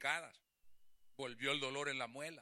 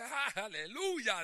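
slow, high, drawn-out "aleluya"; mock rejoicing; 6 semitones higher, 3 dB louder, much slower than usual. Preacher imitates his niece praising God, slow and high. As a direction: imitate her overjoyed cry.

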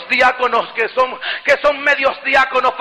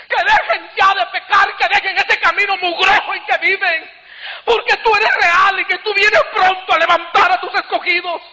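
high-pitched, crying retold plea; tearful pleading; 6 semitones higher than usual. Preacher retelling his plea to return to earth, tearful pleading. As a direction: re-enact a desperate, tearful bargain with God. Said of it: "Mi llanto era continuo".

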